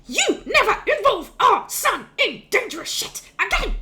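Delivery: high, loud, word-by-word telling-off; furious scolding. Acted. Wife smacking her husband with a ladle, furious scolding. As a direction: punch out each word like a blow.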